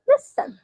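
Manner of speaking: LIS-ten, first syllable pitched high; Listen, weight on LIS and let ten fall away; stress on first syllable. Shown as a demonstration. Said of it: "The stress is in the first syllable".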